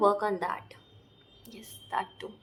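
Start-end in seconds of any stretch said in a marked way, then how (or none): 1.5-2.3 whispered aside to herself. Real, not acted. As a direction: breathe it out with no voice. (खुद से फुसफुसाकर)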